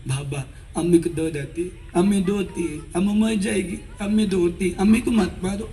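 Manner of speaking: a hint of a little boy's broken speech; stammering child. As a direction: plead like a small stammering boy. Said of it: "నత్తి మాటలు".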